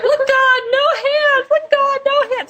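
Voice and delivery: very high, squealed joke exclamation; gleeful mock cry. Joking to friends about a bumper sticker slogan, high gleeful cry. Jokey imagined exclamation, laughing.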